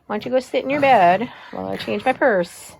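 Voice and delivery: ordinary talk, nothing marked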